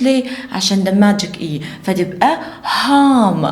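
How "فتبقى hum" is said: drawn-out, sound by sound; slow phonics blend. Shown as a demonstration. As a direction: stretch the word for the kids. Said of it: "Mix the sounds together".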